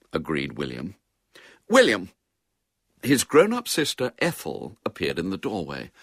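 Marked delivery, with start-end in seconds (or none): 1.7-2.1 sharp, raised call to a brother; impatient sisterly summons; summoning. A narrator's reading. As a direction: call his name louder and higher, falling at the end. Sister calls her brother sharply.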